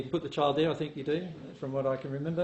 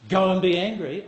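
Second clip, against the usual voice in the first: raised, louder mock order; emphatic, loud quoted urging; mock command; 4 semitones higher, 7 dB louder than usual. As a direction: give a punchy pretend instruction. Acted command he says he isn't giving.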